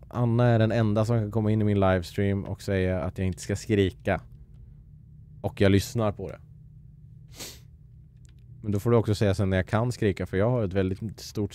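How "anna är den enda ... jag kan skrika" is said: ordinary delivery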